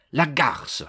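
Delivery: sudden muttered curse with a touch of anger; spontaneous exclamation. A narrator's reading. Suspect curses his mistress, a spontaneous outburst. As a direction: let the insult slip out. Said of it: "cette exclamation spontanée".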